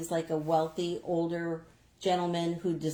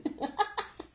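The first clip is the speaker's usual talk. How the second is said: breathy giggle; 11 semitones higher, 3 dB quieter than usual. Real, not acted. Laughing at farmer story.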